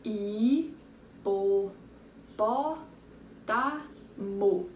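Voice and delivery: "Hipopótamo" split as hi, po, pó, ta, mo with long gaps; very slow, segmented "Hipopótamo"; syllable by syllable. Shown as a demonstration. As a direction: count out five separate beats in "Hipopótamo". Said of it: "Vamos ver".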